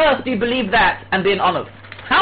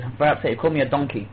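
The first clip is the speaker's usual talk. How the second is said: ordinary delivery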